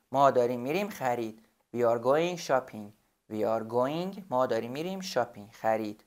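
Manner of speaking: ordinary delivery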